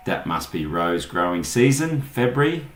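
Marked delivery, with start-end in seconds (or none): none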